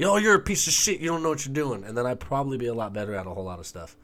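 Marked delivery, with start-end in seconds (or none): 0.0-1.8 mock insult. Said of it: "I wanna hear someone say".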